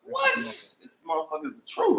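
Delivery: surprised. Real, not acted. Tasting the mocha.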